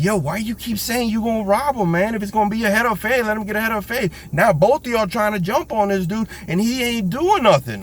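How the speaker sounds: high-pitched voice of a scolding stranger; imitating a bystander scolding two robbers; other dude scolding. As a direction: pitch way up as the guy telling them off.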